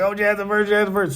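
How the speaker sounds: high, loud mock taunt; mimicked taunting crowd. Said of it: "Everybody like".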